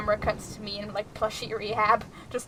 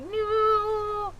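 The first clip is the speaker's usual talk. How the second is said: high, drawn-out mock "no"; hammed-up panicked cry; frantic; 10 semitones higher than usual. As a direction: imitate a frantic wail. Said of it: "frantically, like".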